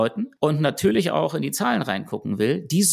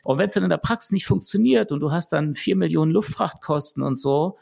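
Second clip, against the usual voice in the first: normal talking voice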